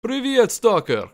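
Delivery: put-on high, loud, slow Stalker greeting in a thick Eastern European accent. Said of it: "But I can emulate Stalker".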